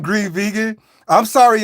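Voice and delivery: ordinary delivery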